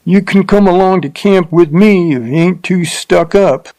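taunting her about being stuck up; rough man, sneering. A narrator's reading. Rough man's dialogue.

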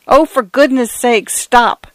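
high, begging plea; frantic plea to the advancing water; pleading cry. A narrator's reading. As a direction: beg the wave to stop. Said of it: "cried Mister Fotheringay".

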